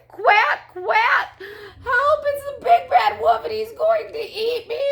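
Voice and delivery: high, loud, tearful duckling shriek; frightened duckling cry. A narrator's reading. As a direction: cry for help in hammed-up terror. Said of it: "cried number five".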